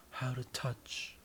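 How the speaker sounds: ordinary talk, nothing marked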